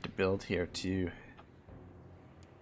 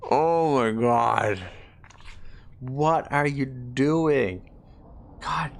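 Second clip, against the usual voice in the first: exasperated; 4 semitones higher, 4 dB louder, much slower than usual. Real, not acted. Exasperated at ally's war.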